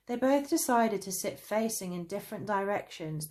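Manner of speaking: plain reading voice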